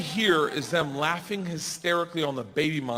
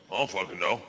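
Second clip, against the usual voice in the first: much lower, softer dog voice; low mumbled dog; dopey dog voice; 10 semitones lower, 4 dB quieter, faster than usual. Pretend. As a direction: voice a baffled dog. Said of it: "our dog's sitting ... like".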